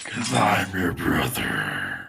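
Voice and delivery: slow, low, soft ghostly rasp; eerie slow reveal; spooky undead whisper. Acted. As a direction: draw it out in a creepy whisper. Undead brother answers.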